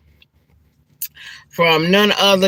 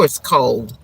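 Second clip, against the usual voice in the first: ordinary talk, nothing marked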